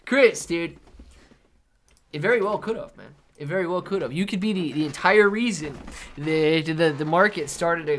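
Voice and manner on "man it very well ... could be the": ordinary talk, nothing marked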